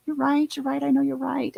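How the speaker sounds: high, rushed sing-song concession; mock a woman who knows she's wrong; high, rushed mock 'you're right'; imitating Carrie Fisher's character. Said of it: "she's like".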